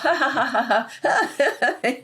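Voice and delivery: high pealing ha ha laugh into breathy laugh; demonstrating laughter. Shown as a demonstration. Laughter yoga teacher showing the host a laugh. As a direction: show them how to laugh. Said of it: "If we could just laugh".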